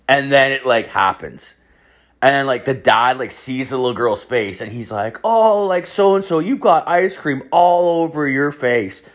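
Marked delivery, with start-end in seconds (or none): 5.2-9.0 high, drawn-out parent voice; dad to child. Retold or imitated. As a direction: imitate a dad cooing at his little girl. Dad talking to his little girl.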